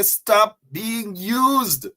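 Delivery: every word slow and separate, stop leaning hard; slow emphatic command. Shown as a demonstration. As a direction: preach it, one word at a time. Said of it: "Turn to your neighbors and say".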